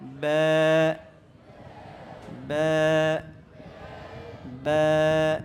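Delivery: ব্যা with a light, fronted "ae" vowel, held for one alif; low, level, drawn-out ব্যা; thin ব্যা. Shown as a demonstration. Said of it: "এটা বলতে হবে কি".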